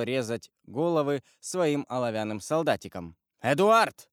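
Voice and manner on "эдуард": put-on sharp loud call of the boy's name; father shouting at his son to obey. A narrator's reading. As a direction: snap the name out loud and high. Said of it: "крикнул Вильфор так резко".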